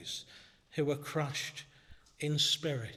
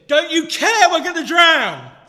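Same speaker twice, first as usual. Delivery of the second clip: high, much louder desperate cry over the storm; frightened shout; 12 semitones higher, 17 dB louder than usual. Retold or imitated. Disciples in the sinking boat crying out to Jesus, panicked shout. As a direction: yell it like a man sure he'll drown.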